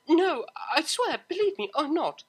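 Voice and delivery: pleading with mother; frantic denial. Acted. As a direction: swear it desperately. Desperate denial.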